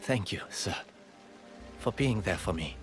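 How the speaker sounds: low, soft, solemn gratitude; heartfelt thanks. Acted. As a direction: thank your mentor quietly and sincerely. Grateful farewell to mentor.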